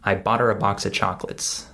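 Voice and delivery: quick, linked words with "her" and "of" reduced; normal-speed reduced speech. Shown as a demonstration. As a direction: run it together like a native speaker. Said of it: "if I say this at normal speed".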